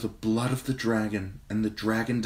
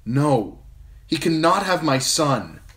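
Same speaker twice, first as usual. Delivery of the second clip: fierce cry; 10 semitones higher, 7 dB louder, slower than usual. A narrator's reading. Mother defying threat to child.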